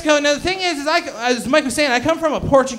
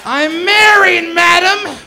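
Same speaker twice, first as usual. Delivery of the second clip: high, loud, drawn-out mock protest; mock indignant shout; 6 semitones higher, 7 dB louder, slower than usual. Comedian to a doubting girl, mock indignant shout. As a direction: protest loudly, as if insulted.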